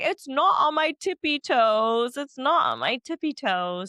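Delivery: high, drawn-out mock protest; Kyle protesting. Podcast host mimicking Kyle's repeated tippy-toes denial, high mock protest. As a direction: imitate an insistent, stretched-out denial. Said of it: "He kept going".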